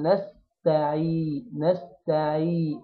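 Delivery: نستعي slow, with a clear ayn and long final ee; drawn-out yaa. Shown as a demonstration. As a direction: hold the yaa of نستعي two counts. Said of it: "ونمد الياء حركتين". (نستعي بمد الياء حركتين)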